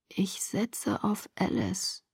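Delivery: very slow, faint whisper; fading out. Acted. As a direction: whisper it while slipping into sleep. Last words before passing out.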